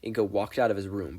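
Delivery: plain reading voice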